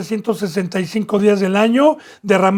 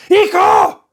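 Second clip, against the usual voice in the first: put-on high, loud alarm shout at a child in danger; commanding shout; 11 semitones higher, 6 dB louder than usual. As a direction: yell to stop him dead. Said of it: "con esa voz".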